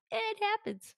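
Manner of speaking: high, breezy shrug; jaded viewer shrugging; shrugging dismissal. Retold or imitated. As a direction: toss it off carelessly. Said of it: "trained me to be like".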